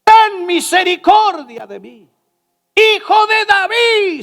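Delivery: shouted plea, loud and high; grito de Bartimeo. Acted. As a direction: cry out to Jesus with all your voice. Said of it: "empezó a gritar, a dar voces". (grito desesperado pidiendo misericordia)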